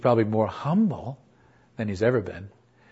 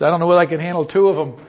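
louder, higher, fast blurt; mock-flustered rush of words; acted overwhelmed protest; 6 semitones higher, 11 dB louder, much faster than usual. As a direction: blurt it out as if it's too much. Acted overwhelmed reply to second question.

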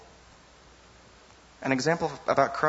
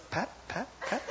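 soft mock pats; 9 semitones higher, 11 dB quieter than usual. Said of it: "and she goes".

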